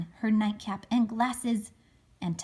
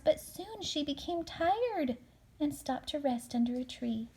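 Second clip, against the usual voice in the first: ordinary delivery, nothing marked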